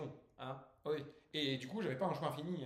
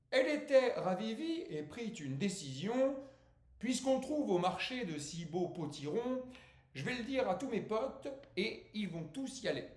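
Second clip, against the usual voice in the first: slow, raised declamation; poetry recitation; 7 semitones higher, 5 dB louder than usual. Acted. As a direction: declaim the verse grandly, stretching the line endings. Declaims his poem. (poème déclamé avec emphase)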